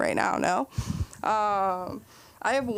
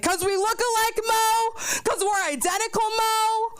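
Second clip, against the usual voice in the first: hammed-up whiny wail, voice pitched way up; high mock outburst; 12 semitones higher than usual. Telling Mo off in the store, hammed-up high wail.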